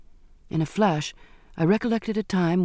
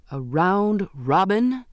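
slow, higher, rising question; astonished exclamation; 4 semitones higher, much slower than usual. A narrator's reading. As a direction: echo it in drawn-out disbelief. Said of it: "he exclaimed".

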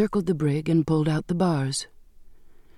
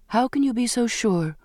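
higher-pitched, doubting question; challenging; 6 semitones higher than usual. A narrator's reading. Condemned girl questions her friend's assurance, challenging. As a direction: challenge him with your pitch raised.

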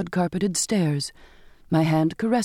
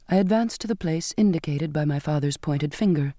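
plain reading voice

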